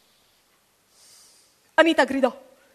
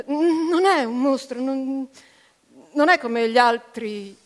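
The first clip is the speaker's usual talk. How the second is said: balbettando incerta, con qualche esitazione; incerta, esitante; 3 semitones higher, 6 dB louder, slower than usual. A narrator's reading. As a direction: cerca le parole, insicura. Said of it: "si interruppe incerta". (voce incerta che si spezza)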